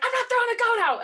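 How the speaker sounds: high, loud indignant protest; a light high Jesper voice, loudly refusing; mock Jesper indignant. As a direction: squeak it out in playful outrage. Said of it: "And Jesper's like".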